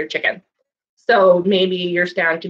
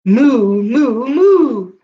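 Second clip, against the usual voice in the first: mock cow moo, a little louder; playful moo moo; 3 semitones higher, 5 dB louder, much slower than usual. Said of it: "What do you think the cow says back?".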